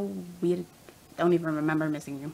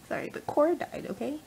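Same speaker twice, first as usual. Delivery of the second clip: ordinary delivery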